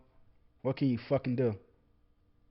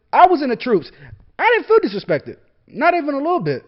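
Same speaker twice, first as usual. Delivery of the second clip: louder, higher rising anger; getting mad; 7 semitones higher, 5 dB louder than usual. Real, not acted. Veteran defending Kaepernick, getting really mad. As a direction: let the anger boil over, louder. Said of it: "I'm getting a little mad".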